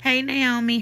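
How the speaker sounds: ordinary talk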